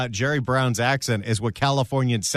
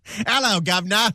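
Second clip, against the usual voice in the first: higher-pitched mock Cockney greeting; Cockney accent; 5 semitones higher than usual. Producer mimics a chimney sweep for the host, in Cockney. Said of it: "If he had a Cockney accent".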